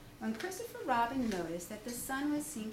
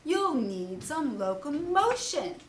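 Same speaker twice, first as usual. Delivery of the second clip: louder, lecturing Owl voice; pompous Owl; 7 dB louder than usual. Acted. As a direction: hold forth like a learned bird. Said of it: "said Owl".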